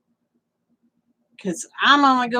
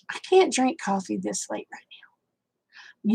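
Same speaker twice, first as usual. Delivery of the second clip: soft whisper; 8 dB quieter than usual. Real, not acted. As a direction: whisper it to yourself. Whispers to herself late.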